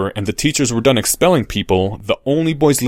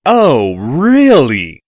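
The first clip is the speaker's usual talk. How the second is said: louder, stretched-out drawl; 2 semitones higher, 7 dB louder, much slower than usual. Acted. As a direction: drawl it loud for the crowd. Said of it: "in the loudest drawl".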